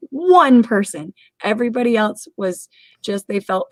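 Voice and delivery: usual speaking voice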